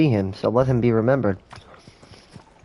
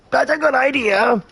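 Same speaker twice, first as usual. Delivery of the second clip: high agreeing voice; 11 semitones higher than usual. Pretend. Imagined official agrees.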